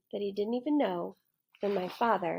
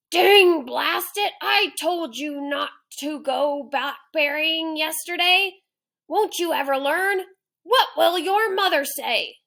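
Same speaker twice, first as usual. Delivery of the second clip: a lion scolding himself in a rage; angry lion roaring; 7 semitones higher, 7 dB louder than usual. Acted. Teacher reading the lion scolding himself, loud angry roar. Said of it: "angry animal roaring".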